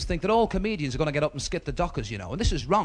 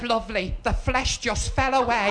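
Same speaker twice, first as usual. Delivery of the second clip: put-on high, slow, drawn-out old gran; deaf gran; 7 semitones higher, slower than usual. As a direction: answer as the hard-of-hearing grandmother. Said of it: "my gran was a bit Mutt and Jeff".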